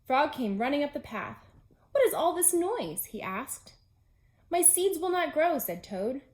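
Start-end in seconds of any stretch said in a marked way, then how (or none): 1.9-3.1 lightly voiced Frog. 4.5-5.6 lightly voiced Toad.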